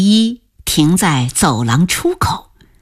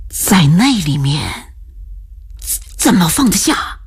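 puzzled. A narrator's reading. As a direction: ask in mild bewilderment, drawing it out. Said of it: "不解地问".

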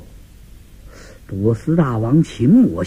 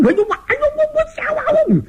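high, piercing cry of five sons at once; joint shout; faster than usual. Acted. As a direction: yell out together, way up high.

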